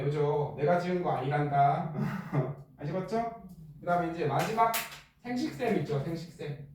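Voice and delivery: ordinary delivery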